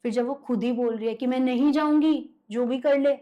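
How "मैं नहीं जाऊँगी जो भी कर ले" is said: louder, slightly raised defiant refusal; imitated possessed voice. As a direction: lightly act out a possessed woman refusing. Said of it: "आवाज बिल्कुल अलग थी". (भूत-प्रेत वाली ज़िद्दी आवाज़ की हल्की नकल)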